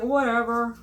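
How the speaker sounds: put-on "who cares"; dismissive whatever. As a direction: toss it off with exaggerated indifference. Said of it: "I'm just like".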